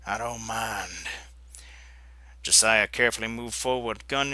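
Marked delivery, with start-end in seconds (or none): 0.0-1.1 slow, low, soft drawl; lazy villain drawl; drawling outlaw. Acted. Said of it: "A drawling voice".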